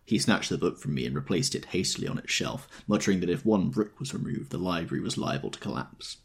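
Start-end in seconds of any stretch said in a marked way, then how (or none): none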